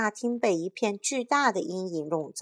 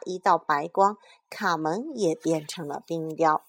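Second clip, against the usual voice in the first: usual speaking voice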